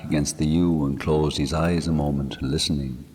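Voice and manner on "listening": ordinary delivery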